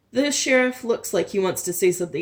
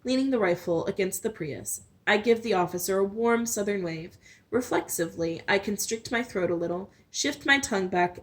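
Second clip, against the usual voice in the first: plain reading voice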